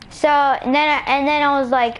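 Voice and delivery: normal talking voice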